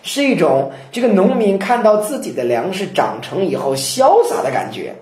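ordinary talk

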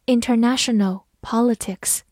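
usual speaking voice

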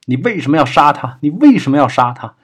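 loud, high-pitched confrontation; accusing shout. A narrator's reading. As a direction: yell the demand at him, distraught. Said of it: "冲过来揪住麻子， 叫道". (激动地大声质问)

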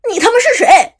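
loud, high, over-the-top yell; exaggerated shout. A narrator's reading. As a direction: ham up the cursing version. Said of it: "喊出了这句话的夸张版本". (夸张地大骂)

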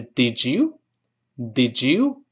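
"Did you" blended into "di-joo", rising; questioning "di-joo" as a linking demo; linked "did you". Shown as a demonstration. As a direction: run "Did you" together with a j sound. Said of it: "连读变成之".

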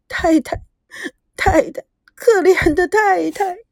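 high, crying voice of pity; tearful. A narrator's reading. Maid helping up beaten mistress, tearful. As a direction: sob out sympathy for her. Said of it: "眼泪婆娑".

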